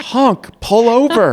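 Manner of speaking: high, slow drawn-out mock shout; mock yell. Retelling the second round of yelling drivers, slow mock yell. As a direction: stretch it out like honking drivers. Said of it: "more people are yelling".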